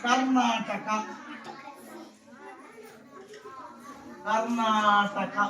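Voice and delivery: slow, drawn-out Karnataka; slow spelling-out. Shown as a demonstration. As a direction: stretch every syllable of Karnataka. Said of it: "చూడు, కర్ణాటక".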